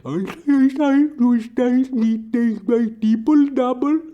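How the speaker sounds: very high, slurred muttering voice; high mumbling narcoleptic coworker; mumbling Sleepy. Retold or imitated. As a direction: babble on in a squeaky high mumble. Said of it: "he just mutters on and on".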